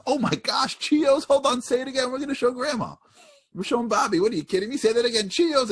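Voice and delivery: very high, gushing excitement; hammed-up squeal of a delighted mother; thrilled mom. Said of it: "You know what mom's doing?".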